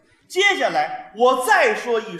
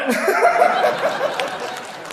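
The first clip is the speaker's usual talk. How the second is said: high laugh; 12 semitones higher, 4 dB louder than usual. Real, not acted.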